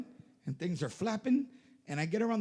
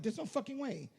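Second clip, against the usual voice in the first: disbelief; 8 semitones higher, 9 dB quieter than usual. Retold or imitated. Disbelieving her tattoo claim.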